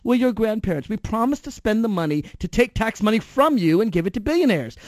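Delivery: mock chauffeur. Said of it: "imagined servant reply".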